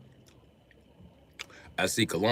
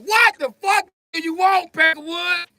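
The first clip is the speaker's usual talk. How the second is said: very high, loud shouted comeback; mock angry shout; 7 dB louder than usual. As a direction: yell back in hammed-up fury. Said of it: "We just like".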